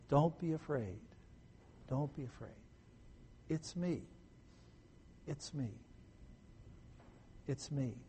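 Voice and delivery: soft, unhurried reassurance; calm the frightened very gently, with long pauses; hushed and soothing. Acted. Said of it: "They talk in a calm, soothing voice".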